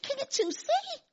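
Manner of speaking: high, soft wondering question; God's gentle question. Retold or imitated. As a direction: ask it in tender awe. Retelling God's words, emotional.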